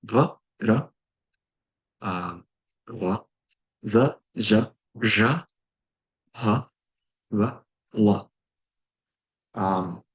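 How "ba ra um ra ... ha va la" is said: each syllable said crisply on its own, nga, ba, da; phoneme demo. Shown as a demonstration. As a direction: clear sound-by-sound demonstration. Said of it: "eerily familiarly to Hangul".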